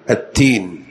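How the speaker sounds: التين with a voiceless t; wrong t sound in التين; ta for dal. Shown as a demonstration. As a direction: say التين, the fruit word, with ت. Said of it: "تذكر الفاكهة المشهورة".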